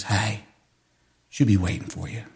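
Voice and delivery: low soft voice of comfort from the Spirit; hushed comfort. Retold or imitated. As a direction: whisper it like a gentle reassurance. Holy Spirit voiced softly.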